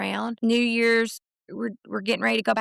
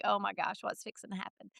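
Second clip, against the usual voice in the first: near-whispered panicked thought, much softer; hushed inner worry; 11 dB quieter, faster than usual. Retold or imitated. Mom voicing her own anxious thought, hushed and soft. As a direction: voice her worried mom brain under her breath. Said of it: "just my mama brain was like".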